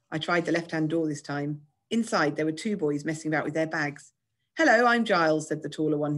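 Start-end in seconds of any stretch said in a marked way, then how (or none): 4.6-5.5 high, loud posh schoolboy; pushy upper-class English boy. A narrator's reading. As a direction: introduce yourself pushily in a posh voice. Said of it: "His voice was very posh and acted very pushy".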